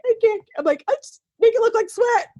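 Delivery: high, tearful voice on the edge of sobbing; crying. Retold or imitated. Retelling crying on the Stairmaster, high and tearful. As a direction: choke it out through tears. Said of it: "crying the whole time on the Stairmaster".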